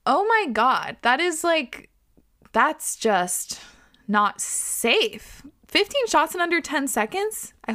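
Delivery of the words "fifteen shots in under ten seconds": high disbelieving question; incredulous. Real, not acted. Incredulous repeat of read line.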